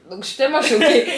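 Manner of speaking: loud shocked laugh. Real, not acted. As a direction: blurt it out in shock. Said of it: "Même mon français est étonné".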